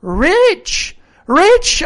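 high, loud repeated exclamation; hot insistence. A narrator's reading. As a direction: cry it out hotly, voice leaping up. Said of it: "he repeated the statement hotly".

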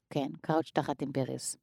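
half to herself, soft and low: "קראוץ' תחת אימפריוס"; trying the guess out quietly; quiet mumble. Real, not acted. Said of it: "אני אומרת לכם את זה". ("קראוץ'" נאמר בשקט, כמעט במלמול)

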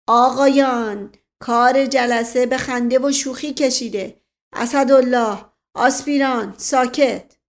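loud, stern call to order; commanding shout. A narrator's reading. Dear Uncle halts the joking meeting with an angry shout. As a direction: shout each name sharply, demand silence. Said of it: "فریاد دایی جان بلند شد".

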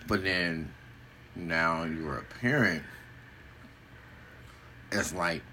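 usual speaking voice

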